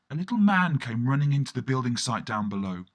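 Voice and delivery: ordinary delivery, nothing marked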